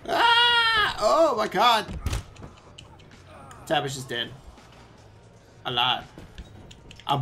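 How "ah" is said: high, loud held "ah"; startled scream. Real, not acted. Player taking a hit in game, screaming. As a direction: yell out on a high note.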